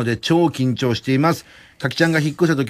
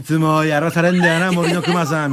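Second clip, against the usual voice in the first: lightly voiced rebel kid, half laughing; mock bad boy; 2 semitones higher than usual. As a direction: toss it off like a wannabe delinquent. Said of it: "ちょい悪入れてきたじゃん". (笑いながら軽くちょい悪ぶった口調)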